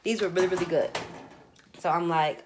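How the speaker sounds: normal talking voice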